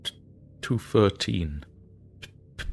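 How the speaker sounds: shaken stammer on the date; a touch of a frightened stammer; stammering fear. Acted. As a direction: stutter through it, barely holding on. Terrified man stammering date.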